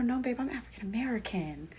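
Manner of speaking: soft, fading down to a whisper; sad quiet reply. Retold or imitated. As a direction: let it sink to a sorry whisper. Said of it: "I sadly have to go".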